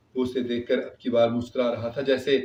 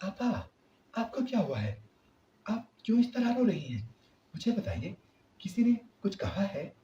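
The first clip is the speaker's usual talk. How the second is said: worried questions; 8 semitones higher, 4 dB quieter than usual. A narrator's reading. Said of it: "گھبرائی ہوئی".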